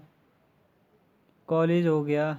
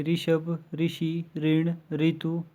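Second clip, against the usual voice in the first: slow example words; much slower than usual. Shown as a demonstration. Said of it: "तो इसके example है".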